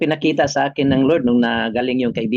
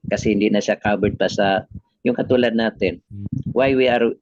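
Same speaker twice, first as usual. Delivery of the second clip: ordinary talk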